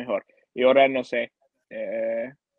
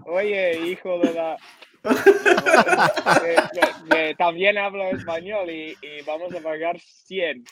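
laughing, higher, half-shouted comeback; laughing retort; 5 semitones higher than usual. Retold or imitated. Tourist calling out the vendor in Spanish, laughing. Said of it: "Y yo dije".

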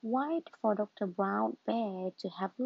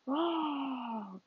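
small playful dinosaur roar; 2 semitones higher than usual. Acted. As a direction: roar like a toddler's toy dinosaur. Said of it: "cries George".

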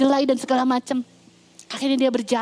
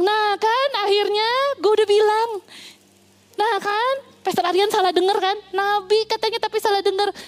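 I told you so; 7 semitones higher than usual. Acted. People mocking the pastor's marriage.

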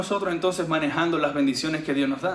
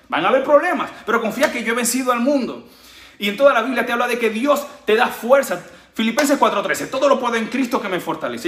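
higher and louder, preaching at full force; fervent preaching; 6 semitones higher, 4 dB louder than usual. Acted. Preacher rallying the congregation with full-force fervor. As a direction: lift the crowd with conviction.

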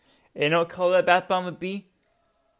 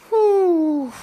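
loud high exhale after ranting; catching breath; 14 semitones higher, 11 dB louder than usual. Real, not acted. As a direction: blow out the heat. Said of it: "I got heated".

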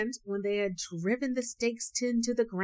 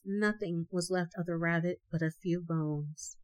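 ordinary delivery

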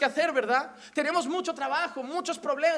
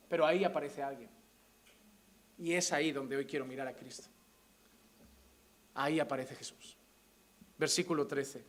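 much lower and softer, heavy and quiet; hushed grief; 10 semitones lower, 7 dB quieter than usual. Real, not acted. Pastor confiding his fear of losing his wife, hushed and low. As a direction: let the grief pull the voice right down.

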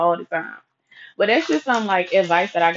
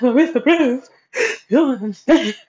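louder, crying protest; teary excuse; 4 semitones higher, 4 dB louder than usual. Retold or imitated. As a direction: whine an excuse through tears. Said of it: "a million and one excuses".

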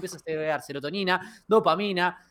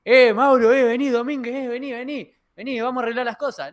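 bully calling out; 7 semitones higher, 5 dB louder than usual. Retold or imitated. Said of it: "Maguila Gorila y toda su banda".

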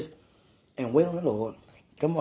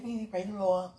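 softer, slightly put-on hello; put-on church greeting; 7 dB quieter than usual. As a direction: lightly act out a church greeting in a voice not your own. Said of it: "I don't even sound like saying".

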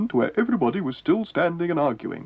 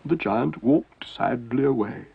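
ordinary delivery

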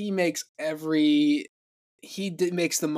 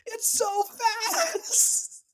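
drawn-out, much higher, very slow wonder; mock amazement; much slower than usual. Retelling paddling through the flooded creek, hammed-up slow wonder. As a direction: stretch it out in silly awe.

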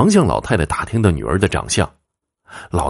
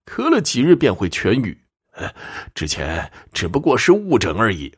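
put-on high-pitched old man, insistent; aggrieved old client; 5 semitones higher than usual. Old man explaining his recovery to the fortune-teller, high and aggrieved.

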